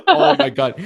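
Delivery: laughing out the words, high. Real, not acted. Laughing at the imagined prank, high giggling. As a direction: crack up mid-phrase.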